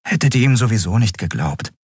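usual speaking voice

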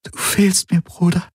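very high, grieving voice; tearful grief. A narrator's reading. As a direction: speak through tears to a dead brother. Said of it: "all ihre Tränen wären aufgebraucht".

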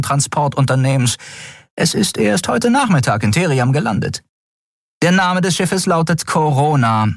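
höhere, militärisch knappe Meldestimme; sachliche Offizierin. A narrator's reading. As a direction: Meldung an den Vorgesetzten. Shia berichtet. (erste Offizierin meldet dem Admiral sachlich, höher)

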